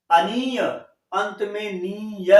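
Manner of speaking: "अंत में नीय" said slowly, stretching "नीय"; slow demo. Shown as a demonstration. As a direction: spell out the ending slowly for the class. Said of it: "न पर बड़ी की मात्रा और य आना चाहिए". ("नीय" को धीरे-धीरे खींचकर बोलना)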